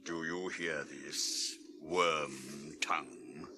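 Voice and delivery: low, slow, whispered threat; menacing whisper. Acted. King confronting the traitor, low whisper. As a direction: let the menace sit quietly.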